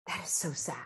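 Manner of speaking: soft unvoiced whisper of pity. Shown as a demonstration. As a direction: whisper it as if only to yourself. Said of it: "it quietly in my own mind".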